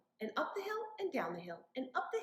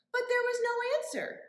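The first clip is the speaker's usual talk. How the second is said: surprised; 12 semitones higher, 8 dB louder, faster than usual. Acted. Story surprise beat.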